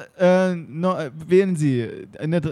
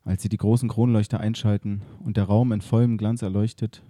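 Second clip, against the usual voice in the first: radio play narration; 4 semitones lower than usual. A narrator's reading. Narrator setting the museum scene, low and hushed.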